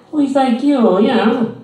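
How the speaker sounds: mocking a patronizing old lady at the mall. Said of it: "old lady voice continues".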